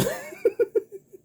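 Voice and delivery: high laugh. Real, not acted. Laughing at the buddy's reply.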